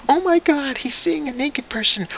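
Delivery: high, shrill-edged mock panic; panicked parent. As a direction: gasp it like a horrified parent. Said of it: "parents go in a full total apeshit mode".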